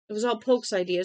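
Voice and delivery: panicked yell to shift the blame; scream. A narrator's reading.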